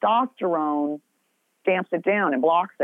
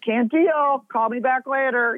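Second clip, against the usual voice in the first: mock brush-off; 8 semitones higher than usual. Hanging up on friends with screaming kids, mock brush-off. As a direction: wave them off, overwhelmed.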